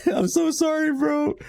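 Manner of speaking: high apology through laughter; laughing. Real, not acted. Gamer apologizing to a homeless villager, laughing. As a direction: giggle out a mock apology.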